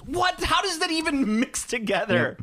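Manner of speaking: high, disbelieving what; baffled, pitch jumping up; incredulous. Real, not acted. Incredulous reaction.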